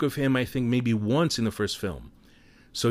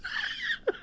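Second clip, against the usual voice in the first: a real burst of high laughter; 7 dB quieter than usual. Real, not acted. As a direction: crack up at your own punchline. Laughs at own joke.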